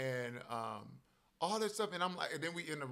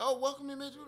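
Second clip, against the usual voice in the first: higher welcoming work voice; 10 semitones higher, much faster than usual. Retold or imitated. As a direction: lift into the friendly store pitch. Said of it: "my voice, I'd be like".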